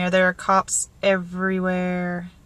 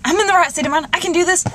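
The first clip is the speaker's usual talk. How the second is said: lightly raised, upbeat inner voice; self pep talk; 8 semitones higher, faster than usual. Retold or imitated. Nervous interviewee lightly replaying her confident pep talk. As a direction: gently psych yourself up. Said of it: "I kept thinking".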